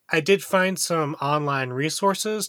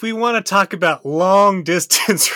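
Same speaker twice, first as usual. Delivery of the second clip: laughing; 5 semitones higher, 8 dB louder than usual. Real, not acted. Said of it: "Are you okay, G?".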